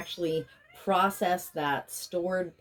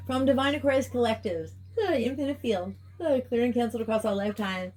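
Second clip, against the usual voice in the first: higher, sing-song chanted invocation; healing invocation; 5 semitones higher, faster than usual. Real, not acted. Healer invoking release for the Divine Aquarius Collective, chanted. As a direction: intone it like a ritual prayer.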